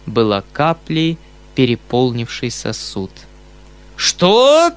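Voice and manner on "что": a high, loud bellow; roaring. Acted. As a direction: roar it out in outrage. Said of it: "заревел толстяк".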